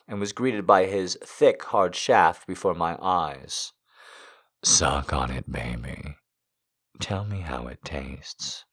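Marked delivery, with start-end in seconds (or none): 4.6-8.6 lower, slow and intimate; low seductive voice. A narrator's reading. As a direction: coax her low and slow. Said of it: "he said".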